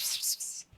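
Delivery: soft, voiceless "pss pss pss"; whispery kitty-summoning noise; cat-calling pss. Shown as a demonstration. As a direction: hiss it softly like calling a cat. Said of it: "I read it as pss".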